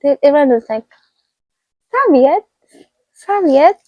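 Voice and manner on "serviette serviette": high questioning voice; imitating a bewildered table; mimicked puzzled echo. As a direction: pitch it up, baffled by the word.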